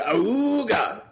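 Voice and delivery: slow, high voiced car horn; ahooga horn imitation. Shown as a demonstration. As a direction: mimic an old car horn. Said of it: "Like an awooga horn?".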